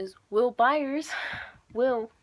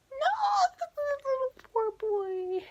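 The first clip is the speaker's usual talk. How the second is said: very high squealed mock wail; anguished wail; slower than usual. Fan reacting to a boy taken away, anguished high wail.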